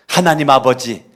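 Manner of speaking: ordinary talk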